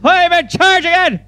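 barking high drill sergeant. Pretend. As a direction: shout orders at recruits. Drill sergeant role shouting.

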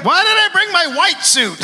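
high mock-whiny lament; hammed-up high whine over a suit; whiny son voice. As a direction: wail it like a hopeless son. Mocking the meek son.

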